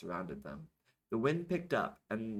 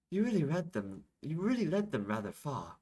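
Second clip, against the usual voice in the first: put-on higher-pitched, impressed remark in a character accent; 7 semitones higher, 3 dB louder than usual. Isabel remarks on Yashish's feat, impressed. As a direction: lift the pitch, admiring.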